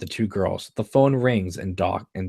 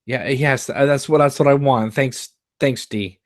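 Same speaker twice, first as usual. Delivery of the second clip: emotional; 5 semitones higher, 5 dB louder than usual. Acted.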